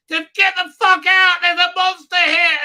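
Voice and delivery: very high, loud mock scream; hammed-up panicked yelling; frantic warning shout. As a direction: shriek at everyone to flee. Yelling a warning about monster.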